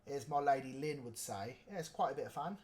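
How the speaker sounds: put-on rough go-to British accent; mock British accent. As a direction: imitate a British friend, loosely and quietly. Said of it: "I actually don't know what your accent sounds like".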